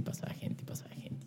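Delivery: soft unvoiced fading repetition; whispered waiting. Waiting outside as people keep passing, whispered. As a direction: let the waiting drag in a whisper.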